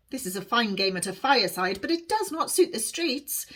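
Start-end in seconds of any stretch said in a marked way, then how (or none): none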